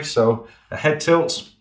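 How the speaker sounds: usual speaking voice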